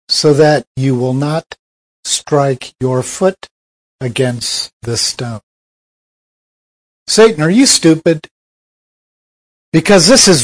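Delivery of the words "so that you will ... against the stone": slow, hushed, sinking lower; fading to a hush. Acted. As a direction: drop low and soft, slowing right down. Satan's quote trails off.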